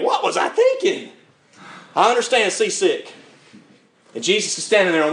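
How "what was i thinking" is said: high, comic wail; mock self-reproach. Seasick preacher regretting the cruise, mock high-pitched wail. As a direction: squeal it in hammed-up regret. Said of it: "laid there sick the whole time thinking".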